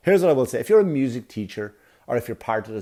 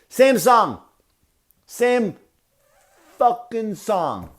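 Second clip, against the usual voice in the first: exasperated rant; 9 semitones higher, 3 dB louder, much slower than usual. Real, not acted. Ranting about the recital.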